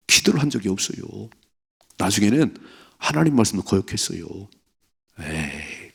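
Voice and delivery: ordinary talk, nothing marked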